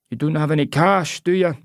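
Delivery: put-on high, persuasive old local; old Scottish man. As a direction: coax her like a cheeky old seller. Old man asks for cash.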